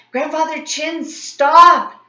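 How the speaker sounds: high, loud shout upward. A narrator's reading. Boy shouting up to grandfather on the roof, loud shout. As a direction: call it up to the rooftop. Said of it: "I shouted up to the rooftop".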